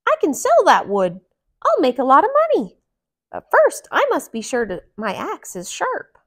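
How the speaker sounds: put-on high, bright-eyed young hero voice; high eager woodcutter voice. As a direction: voice Mickey as a keen young woodcutter. Mickey's thoughts voiced.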